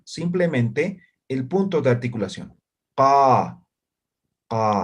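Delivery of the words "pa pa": two clear pa demo syllables; articulation demo. Shown as a demonstration. Teacher showing students the point of articulation. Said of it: "me van a articular casi en la garganta".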